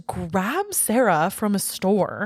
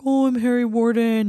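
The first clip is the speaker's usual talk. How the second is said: spooky killer voice; 3 semitones higher, 3 dB louder than usual. Retold or imitated. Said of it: "They're like".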